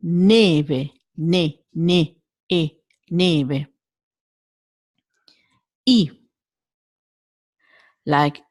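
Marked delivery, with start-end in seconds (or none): 0.0-3.7 neve with tight closed E sounds; Italian closed E. Shown as a demonstration. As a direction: keep the E of neve narrow and closed.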